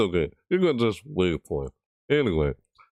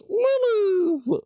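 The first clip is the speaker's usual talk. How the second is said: very high, stretched squeal; squeaky excited minis. Real, not acted. Streamer thrilled by the minis reward, high squeal. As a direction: squeal the word with glee.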